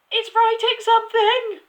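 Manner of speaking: very high, loud schoolboy shriek; piercing, frantic squeal; screaming. A narrator's reading. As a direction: scream it in hysterical excitement. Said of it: "Nigel screamed".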